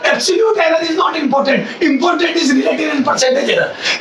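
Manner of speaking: teacher hammering the main point home; key rule stated. Shown as a demonstration. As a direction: spell out each word slowly and firmly. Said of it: "मैं ये बोलना चाहता हूँ".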